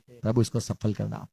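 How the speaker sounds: soft, low, heartfelt prayer; mean every word, gently; a quiet blessing from the heart; sincere from the heart. Shown as a demonstration. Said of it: "पर दिल से बोलते".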